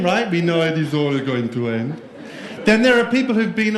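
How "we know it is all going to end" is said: put-on gloomy Northern European accent; put-on European doom. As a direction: imitate a fatalistic European. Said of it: "This is European".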